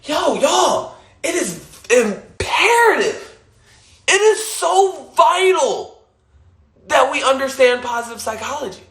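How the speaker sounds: high, slow, emphatic plea to everyone; excited rallying call. Retold or imitated. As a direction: call out to y'all like it's urgent. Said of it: "every day I'm like".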